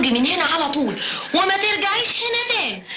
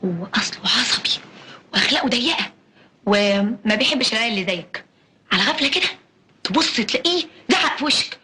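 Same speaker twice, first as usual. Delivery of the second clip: lowered voice telling a scary tale; ominous warning; 9 semitones lower than usual. Acted. Scaring the child about Kamal.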